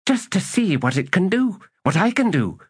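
put-on high, vehement girl's voice; sudden vehemence. A narrator's reading. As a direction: push it out high and insistent. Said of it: "she said with sudden vehemence".

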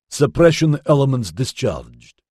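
clipped, emphasis-free readout; clipped monotone. A narrator's reading. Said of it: "voice clipped and devoid of emphasis".

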